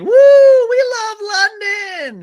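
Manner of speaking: high, loud, drawn-out holler; mock tourist cheer. Mimics tourists cheering London.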